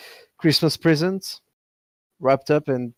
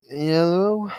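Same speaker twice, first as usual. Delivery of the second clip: sleepy, just woken up hello; tired voice; 3 semitones higher than usual. Acted. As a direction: answer the phone half asleep. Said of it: "You hear a tired voice say".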